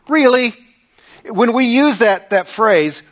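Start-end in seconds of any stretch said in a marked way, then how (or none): 0.1-0.5 high, loud, incredulous "really?"; mocking disbelief. Shown as a demonstration. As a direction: scoff at a friend's curfew with a soaring pitch. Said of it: "the tone in my".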